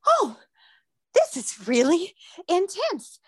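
high, slow, startled reaction; overwhelmed realization. Retold or imitated. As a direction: let the intensity hit you, pitch jumping. Retold sudden strong contraction.

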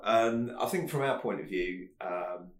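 ordinary talk

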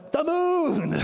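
put-on high, childlike "moon" full of wonder; preacher doing his toddler's amazed call. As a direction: gasp it out like a small child seeing it first. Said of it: "When was the last time you went".

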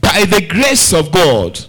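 slow, drawn-out, forceful prayer; fervent declaration. Real, not acted. Preacher leads congregation in thanks, declaring fervently. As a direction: declare each line with full conviction.

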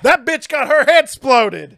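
loud, high, slowed-down exclamation; big hyped-up shout; excited outburst. Real, not acted. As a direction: blurt it out with huge relish. Gleeful outburst about favorite kill.

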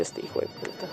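breathy whisper of desire; whispered thought. Retold or imitated. Thinking about the attractive new coworker, whispered.

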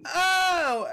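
loud, high exclaimed "oh"; hammed-up high cry of realization; mock realization. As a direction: imitate her suddenly catching on, loudly. Acting out her sudden realization.

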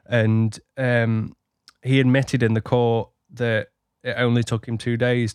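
normal talking voice

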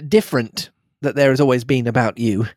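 ordinary delivery

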